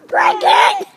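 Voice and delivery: high loud yelled dare; shouting. Real, not acted. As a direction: yell the dare at full volume. Daring friend to break wall.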